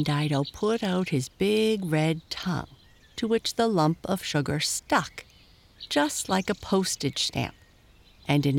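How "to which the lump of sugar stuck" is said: plain reading voice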